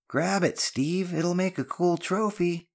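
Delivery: very high-pitched egging-on call; shouting. A narrator's reading. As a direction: shout down a teasing dare, pitch way up. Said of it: "Ben's shout echoed".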